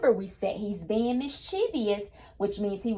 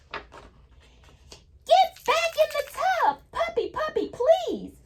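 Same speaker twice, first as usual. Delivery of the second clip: very high, slow sing-song plea; storybook reading voice; 4 dB louder, slower than usual. Acted. Teacher reading the bath page to kids, high slow sing-song. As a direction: stretch it out like a child coaxing a pup.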